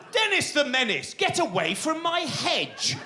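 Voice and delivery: high, slow grumpy neighbour yelling; tetchy neighbour. Retold or imitated. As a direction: shoo a kid off the hedge like a sitcom grump. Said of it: "tetchy neighbour in a poor quality comedy series".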